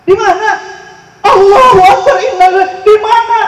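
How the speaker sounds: loud, high, frantic questions into the phone; panicked shouting. Retold or imitated. Family member shouting into the phone on hearing of the crash, panicked. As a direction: cry out in alarm at terrible news.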